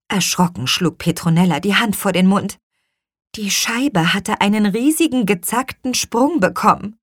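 usual speaking voice

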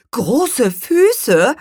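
loud, high questioning echo; pricking up ears. A narrator's reading. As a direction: repeat it with sudden keen interest. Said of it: "horchte Spargelzahn auf".

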